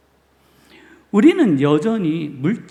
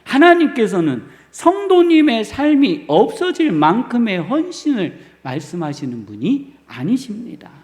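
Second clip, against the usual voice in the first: louder, higher preaching in full conviction; fervent preaching; 3 semitones higher, 6 dB louder than usual. Acted. Pastor assures the congregation God repays, fervent preaching. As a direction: proclaim it with rising fire.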